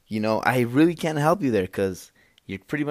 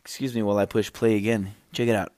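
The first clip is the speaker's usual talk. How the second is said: usual speaking voice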